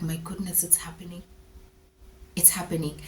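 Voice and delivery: very soft, almost under the breath; hushed disbelief. Retold or imitated. Mother in theater realising birth is happening, hushed. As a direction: murmur it to yourself as it sinks in.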